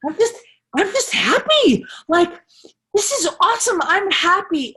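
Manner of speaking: put-on high, bubbly kid voice, bursting with joy; excited little son. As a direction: imitate a thrilled child gushing to mom. Son telling mom he's happy.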